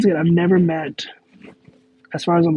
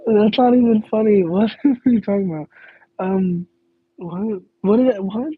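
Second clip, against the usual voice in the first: higher and louder, breaking into a real laugh; laughing disbelief; 6 semitones higher, 4 dB louder than usual. Real, not acted. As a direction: laugh through the protest. Laughing at a baffling joke.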